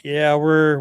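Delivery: ordinary talk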